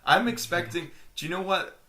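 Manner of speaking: usual speaking voice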